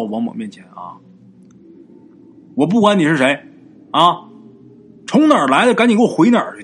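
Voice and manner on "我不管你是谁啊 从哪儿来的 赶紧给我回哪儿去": loud, raised-pitch stern order; forceful elder confronting a spirit; stern command. A narrator's reading. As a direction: order an intruder out. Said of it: "表情很严肃啊".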